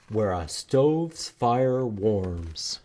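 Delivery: ordinary talk, nothing marked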